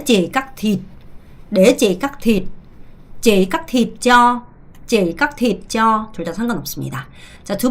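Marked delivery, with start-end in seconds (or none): 0.0-6.1 slow repeat-after-me. Shown as a demonstration. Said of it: "mọi người nói theo cô".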